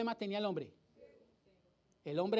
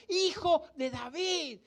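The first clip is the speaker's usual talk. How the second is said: drawn-out, higher-pitched cry for mercy; retold shouting; 6 semitones higher, 3 dB louder than usual. Preacher acting the blind man calling to Jesus, retold shouting. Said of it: "empieza a gritar".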